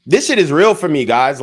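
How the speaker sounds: really mean it, all fired up; worked up. Real, not acted. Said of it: "I don't like to get worked up on stream".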